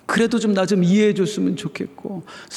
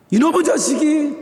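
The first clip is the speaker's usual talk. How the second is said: mock scolding; 9 semitones higher than usual. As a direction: snap at the ungrateful kid, half in jest. Parent scolding child, then retracts. (자식에게 높게 꾸짖는 흉내)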